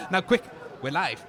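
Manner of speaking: ordinary talk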